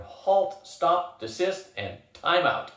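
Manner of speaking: high, emphatic string of commands; roaring. A narrator's reading. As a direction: bellow each order separately. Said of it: "Oz roared".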